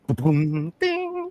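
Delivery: high ringing vocal ding; imitated gong. Chinese man makes his entrance, mock gong sound. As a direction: mouth a boom then a high chime.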